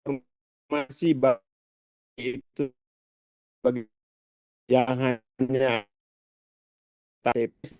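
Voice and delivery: bad connection, words cutting out; choppy breaking audio. Real, not acted. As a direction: voice dropping in and out like a weak signal. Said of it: "suaranya terputus-putus". (suara putus-putus karena sinyal)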